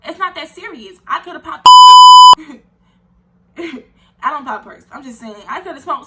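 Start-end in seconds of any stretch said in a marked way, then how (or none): 1.7-2.6 high, loud burst of laughter; loud shrieking laugh. Real, not acted. To the viewers, a loud high shrieking laugh. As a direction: shriek with laughter at your own joke.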